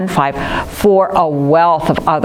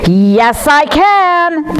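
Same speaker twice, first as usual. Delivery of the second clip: much higher, loud cheering shout; triumphant rallying yell; group yell; 11 semitones higher, 3 dB louder, much slower than usual. Shown as a demonstration. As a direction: shout it out with the class. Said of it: "we're all gonna yell".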